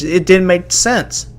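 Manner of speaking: ordinary delivery, nothing marked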